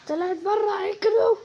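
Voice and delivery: high, loud yell to run; panicked call to teammates; urgent shout. Real, not acted. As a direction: bark the order to sprint. Ball goes out, urging team.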